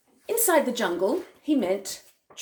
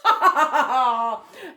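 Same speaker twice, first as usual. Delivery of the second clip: loud villainous cackle; crocodile belly laugh; 3 semitones higher, 7 dB louder than usual. Acted. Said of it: "laughed out loud again".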